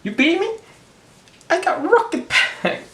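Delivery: high, whiny cartoon disbelief; a squeaky sore loser; Sonic voice. Sonic losing the race in the ad, high cartoon whine. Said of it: "And Sonic goes".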